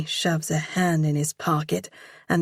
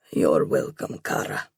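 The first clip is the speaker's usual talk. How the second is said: much lower, fading to a whisper; tender low murmur; 11 semitones lower than usual. Acted. Stranger softens warmly.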